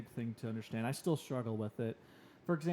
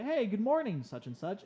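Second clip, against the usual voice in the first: high, eager good morning; cheery hello to a coworker; bright greeting; 7 semitones higher, 3 dB louder than usual. Retold or imitated. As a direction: greet her brightly, pitched well up.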